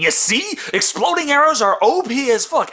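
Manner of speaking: high-pitched outburst at normal volume; mock screaming. Reviewer's bones crying out about the movie, mock screaming. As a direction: burst out with it, pitch way up. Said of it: "every bone in my body is just screaming".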